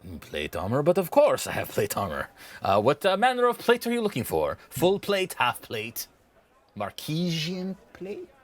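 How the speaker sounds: showy shopkeeper with swooping pitch; obliging salesman, lively tune; eager merchant. Acted. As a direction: reel off options to tempt a buyer. Game master voices merchant.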